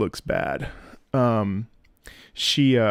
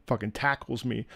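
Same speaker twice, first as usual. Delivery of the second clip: ordinary talk, nothing marked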